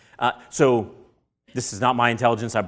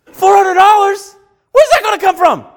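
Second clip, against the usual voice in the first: shocked first reaction; 11 dB louder than usual. Retold or imitated. Preacher reliving his first reaction to the repair bill, alarmed. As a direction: blurt it out in hammed-up alarm. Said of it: "what was my first reaction".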